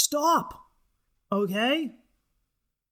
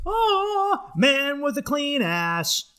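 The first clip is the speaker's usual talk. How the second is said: high, slow heroic call; superhero hype; 6 semitones higher, much slower than usual. Pretend. Hyping himself up as Bidet Man, slow heroic call. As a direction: stretch it out like a hero's title.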